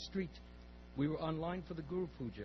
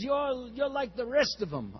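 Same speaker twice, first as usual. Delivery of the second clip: put-on Russian accent; 7 semitones higher, 8 dB louder, slower than usual. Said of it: "I'll imitate a little bit to him".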